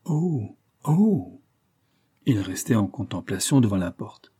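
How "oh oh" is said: each oh on its own higher tone; vary the pitch of every oh, stepping up; three different tones of amazement; oh on rising tones. A narrator's reading. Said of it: "fit sur trois tons différents".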